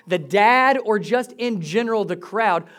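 usual speaking voice

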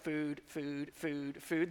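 low, soft, dreamy chant of food; dazed mumble. Acted. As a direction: drone it like hungry dazed disciples. Disciples only thinking of food.

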